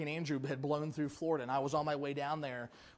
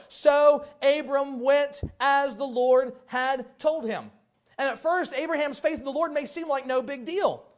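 loud, high sermon delivery; full-force preacher voice; fervent preaching; 7 semitones higher, 8 dB louder than usual. Acted. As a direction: press the congregation with questions.

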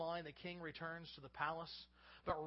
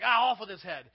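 mock angry king; 15 dB louder than usual. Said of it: "He wasn't like".

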